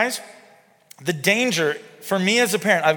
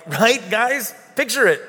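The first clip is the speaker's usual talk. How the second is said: smirking Jesus; 5 semitones higher, 3 dB louder, slower than usual. Retold or imitated. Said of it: "he might have been smirking".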